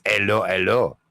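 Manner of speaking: English accent, hello with the H dropped, ello ello; greeting in an English accent, H dropped. Shown as a demonstration. Midlands guest tells Betty to say it the English way, English accent. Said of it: "you need to say that".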